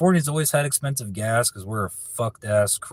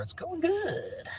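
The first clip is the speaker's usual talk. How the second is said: much higher, slow, put-on voice; high silly voice; 5 dB quieter, slower than usual. Answering a viewer's question about his night, high goofy voice.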